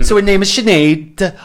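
a clumsy Welsh lilt; Welsh accent. Acted. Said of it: "putting on the Welsh accent".

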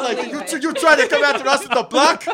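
high, loud mock gang taunt; tough street kid. Inner city kids taunting Pennywise, mock tough. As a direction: taunt the clown, laughing.

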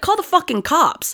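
retold urgent order. Ordering her friend to call police, heated. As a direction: bark it at a friend in the heat of it. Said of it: "I was like".

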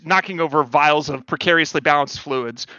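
usual speaking voice

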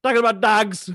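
high-pitched Canadian "dogs", breaking into laughter; Canadian accent. Shown as a demonstration. Poking fun at his own misheard word, Canadian accent. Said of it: "That was my Canadian accent".

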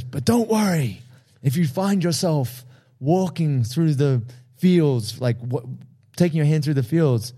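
imitating Maximus. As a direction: play the general comforting his doomed men. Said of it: "And he says".

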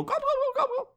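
shrill-high run of mimicked gobbles; very high turkey impersonation; imitated turkeys answering. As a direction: gobble back like a flock of turkeys. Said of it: "and they go".

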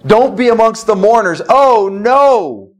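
pleading end-times preaching; urgent preaching. Acted. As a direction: build the warning to a cry. Urgent warning about the end.

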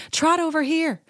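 high, eager stage-mom calling. Retold or imitated. Assistant filming Sutton's trot, high stage-mom cheer. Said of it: "stage mom energy".

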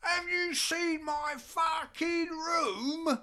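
high, slow, loud indignant demand; indignant outburst. Retold or imitated. Drummer outraged at his hotel suite, high slow indignant shout. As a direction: drag it out, outraged. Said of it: "He goes".

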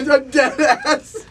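laughing through a quote. Retold or imitated. Retelling friend's odd remark.

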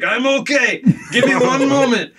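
high, loud mock foreign kid; Russian kid. Storyteller imitating the kid rustling under tarps, high and loud. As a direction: call out in a hammed-up high voice. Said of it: "and he's like".

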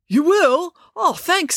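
high, delighted disbelief; surprised gratitude. A narrator's reading. Guard is offered cover for his post, surprised and grateful. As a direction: jump up in pitch, thankful.

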